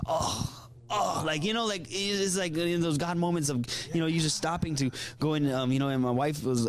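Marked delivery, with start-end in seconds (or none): 0.0-1.2 moved gasp. Retold or imitated. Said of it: "I was like".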